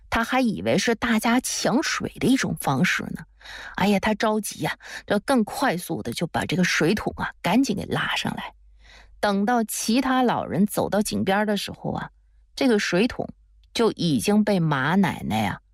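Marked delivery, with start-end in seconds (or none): none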